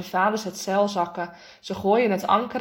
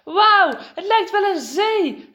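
high, loud, drawn-out wonder; boy crying out, thrilled by the view; excited exclamation; 9 semitones higher, 6 dB louder, slower than usual. Acted. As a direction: call it out in amazement. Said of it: "roept Lucas uit".

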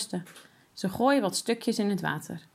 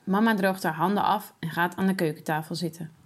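plain reading voice

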